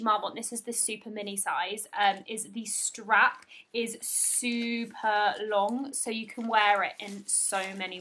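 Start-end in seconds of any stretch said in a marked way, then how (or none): none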